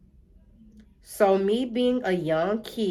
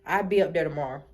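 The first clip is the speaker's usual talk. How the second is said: low, soft, settled promise; a hint of mama calmly promising; mama's voice; 9 semitones lower, 3 dB quieter than usual. Mama promising her son she'll come, low and calm. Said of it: "She like".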